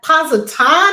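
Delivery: thrilled announcement rising at the end; excited call-out. Real, not acted. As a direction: sing out the place name with relish. Calling out viewers' dream destinations.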